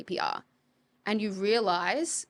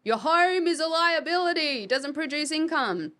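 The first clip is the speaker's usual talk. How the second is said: higher, louder pronouncement of Robert Kiyosaki's maxim; quoting Robert Kiyosaki; 8 semitones higher, 4 dB louder than usual. Retold or imitated. Investor quoting Robert Kiyosaki, high and loud. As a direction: boom it out like a famous guru. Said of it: "this is a quote from Robert Kiyosaki".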